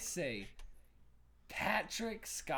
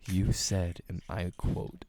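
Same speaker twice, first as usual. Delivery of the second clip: close-mic radio hush; 8 semitones lower, slower than usual. Pretend. Mock NPR quote, leaning in.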